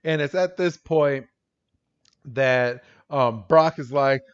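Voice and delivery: ordinary talk, nothing marked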